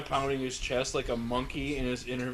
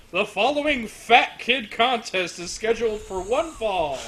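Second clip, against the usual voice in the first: ring announcer; 5 semitones higher, 5 dB louder than usual. Retold or imitated. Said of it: "sounded like the announcer said".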